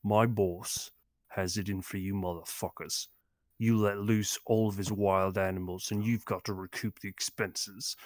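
little girl turns gangster, lightly voiced London menace; British gangster. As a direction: threaten like a Guy Ritchie thug, lightly voiced. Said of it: "this little girl is a Peaky Blinder?".

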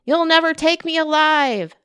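high, loud defiant pirate cry. Acted. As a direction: shout it like a cornered pirate. Pirate Emma defies capture.

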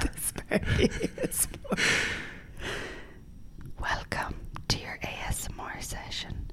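soft welcoming whisper; asmr host whispering a welcome. Pretend. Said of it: "asmr performance".

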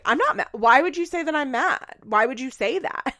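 high, loud mock protest; Farrah. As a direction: deny being mad with put-on indignation. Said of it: "doing, like, exact opposites".

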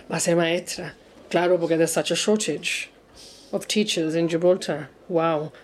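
deadpan sarcasm, low and soft; mock being impressed in a low, unimpressed voice; sarcastic. Speaker mocks the prestige of becoming a teacher, sarcastic. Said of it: "I'm being sarcastic".

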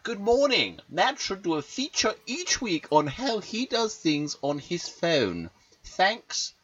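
high, loud Geordie impersonation; Geordie accent. Acted. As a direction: read it out as a high-pitched Geordie listener. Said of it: "you did the voice exactly how she sounds".

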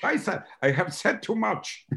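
high, slow mock German dismay; hammed-up German secretary cursing herself; German accent, horrified at the slip. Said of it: "and she said".

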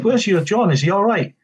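high, sweetly innocent retold question; mock innocent.

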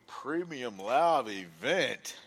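spelled out slowly in mock respect; slow mocking drawl. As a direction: draw out each word mockingly. Repeating the name slowly.

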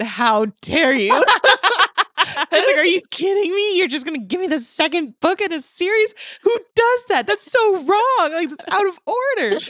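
high, loud hammed-up indignation; mock outrage. Daughter mock-scolding mom over the wrong book, high and loud.

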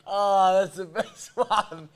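speaking through laughter; slow, wheezing through laughs; laughing. Real, not acted. Cracking up at clip.

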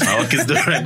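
playful laugh. Shown as a demonstration.

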